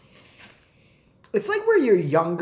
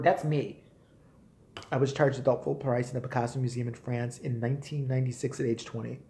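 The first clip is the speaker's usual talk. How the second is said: ordinary talk, nothing marked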